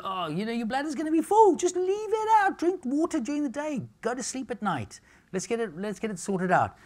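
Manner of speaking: high-pitched, exasperated mock rant; exasperated acted telling-off. Acted scolding about night-time water drinking.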